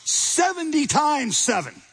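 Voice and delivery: high, slow, drawn out; emphatic seventy times seven. Retold or imitated. Jesus answering Peter, high slow emphasis. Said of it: "So Jesus responds by saying".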